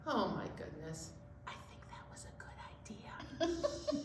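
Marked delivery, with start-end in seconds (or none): 1.5-4.0 whispered aside to the listeners; conspiratorial whisper. Acted. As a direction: lean in and whisper it.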